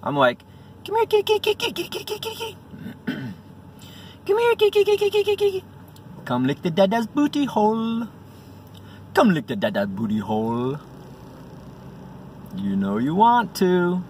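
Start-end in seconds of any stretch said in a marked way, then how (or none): 0.9-2.6 very high, rapid kitty call; sweet coaxing. Acted. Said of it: "I tried being sweet to it". 4.3-8.1 put-on high-pitched baby talk to a cat; sweet coaxing. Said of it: "I tried being sweet to it". 9.1-14.0 lightly voiced cajoling, sliding pitch; sweet coaxing. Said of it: "I tried being sweet to it".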